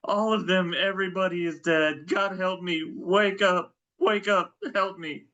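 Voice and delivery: high, pleading, frantic caller; panicked caller. Pretend. Panicked caller in role-play.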